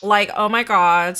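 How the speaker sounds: a loud mock Valley Girl oh my god. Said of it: "I'm gonna talk like this".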